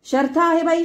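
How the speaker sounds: high loud exasperation on शर्थ आहे बाई; loud exclamation; exasperated cry. A narrator's reading. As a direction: throw up hands in disbelief. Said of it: "पार टिपेच्या सुरात ओरडली".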